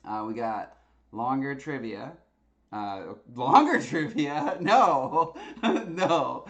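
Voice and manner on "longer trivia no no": loud, incredulous laughing refusal; laughing disbelief. Real, not acted. Host balking at the longer trivia poll result, laughing in disbelief. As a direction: balk at it loudly through laughter.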